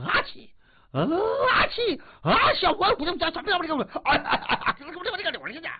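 high choking sneezes and pleading; hammed-up sneezing fit; mock sneezing. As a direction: sputter and beg as the powder hits. 都不是人动静了.

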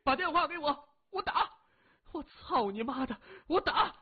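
very high, strained yelling; desperate howl. A narrator's reading. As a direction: howl it out like a man about to die. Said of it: "他嚎叫了一声". (声嘶力竭地嚎叫)

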